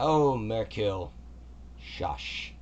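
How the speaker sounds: slow, brushing-off hush from the god voice; dismissive god. Acted. Kelemvor slowly hushes the old man Merkil. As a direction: wave the old man off lazily.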